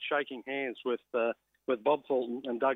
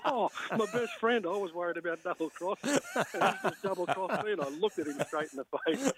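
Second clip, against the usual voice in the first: laughing disbelief; 6 semitones higher than usual. Real, not acted. Telling how his best mate out-bargained him, laughing. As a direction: talk through the giggles, wounded and amused. Recalling mate's bigger contract.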